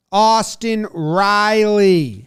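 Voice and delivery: drawn-out, loud stadium announcer; slow announcer call. Acted. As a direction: stretch each word like a PA call. Announcing player stats.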